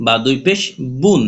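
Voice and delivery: ordinary delivery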